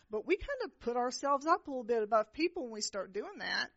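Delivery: ordinary talk, nothing marked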